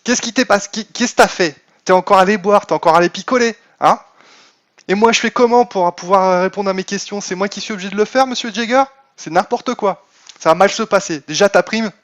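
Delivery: mock angry scolding. Streamer scolds his absent secretary, mock angry. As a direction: scold a lazy employee in jest, voice up.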